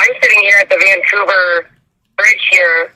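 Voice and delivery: normal talking voice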